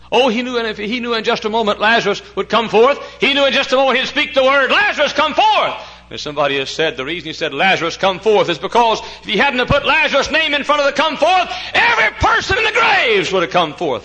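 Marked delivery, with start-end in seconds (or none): 0.1-5.7 loud, high cry climbing to a shout; full-force preaching. Real, not acted. Preacher retelling Jesus calling Lazarus from the grave, full-force preaching. 7.5-14.0 loud voice rising to a shout; building preaching. Real, not acted. Preacher explaining why Lazarus was named, building to a shout.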